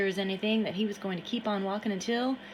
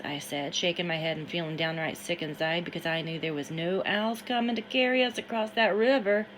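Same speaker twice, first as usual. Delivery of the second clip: plain reading voice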